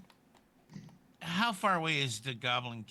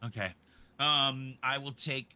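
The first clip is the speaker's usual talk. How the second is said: ordinary delivery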